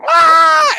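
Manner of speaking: high, loud shriek of fright; mock scream. Friends spotting her floating face down, mock shriek. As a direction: shriek as if finding a body.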